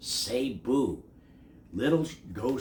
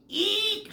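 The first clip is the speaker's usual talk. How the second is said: very high frightened eek; squeak; 4 dB louder than usual. A narrator's reading. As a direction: squeak like a startled bat. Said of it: "squeaked the bat".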